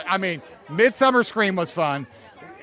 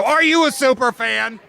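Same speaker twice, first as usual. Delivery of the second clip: calling out; 6 semitones higher, 8 dB louder than usual. Real, not acted. Host rallying the crowd.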